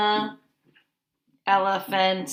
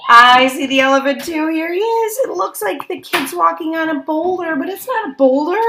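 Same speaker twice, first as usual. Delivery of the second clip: much higher and louder, thrilled; excited; 7 semitones higher, 10 dB louder than usual. Acted. Teacher spots the elephant with the children, higher, louder and thrilled. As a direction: burst out with excitement.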